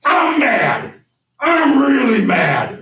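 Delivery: imitating a killer bellowing in fury; mad shout. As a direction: shout it like a raging brute with a knife. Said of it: "he goes".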